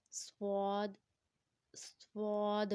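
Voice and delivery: سواد drawn out with a whistling S; whistle sound. Shown as a demonstration. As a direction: hold the hissing, rounded S of سواد. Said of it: "وسل sound کے ساتھ پڑھنا ہے".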